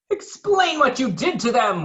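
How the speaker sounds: high, loud demand. Acted. Waffle demands an explanation, high and loud. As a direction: demand an answer sharply. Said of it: "Waffle demanded".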